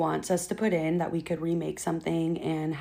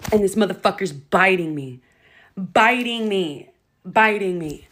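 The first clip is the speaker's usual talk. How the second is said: outraged telling; 6 dB louder than usual. Real, not acted. Telling of being bitten.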